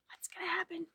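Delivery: put-on hushed whisper to the kids; suspense whisper. Teacher whispers to children waiting for the crash.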